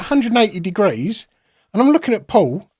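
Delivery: usual speaking voice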